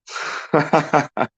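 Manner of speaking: high burst of real laughter at a chat joke. Real, not acted. Streamer laughing at viewer's riff raff joke, real laugh.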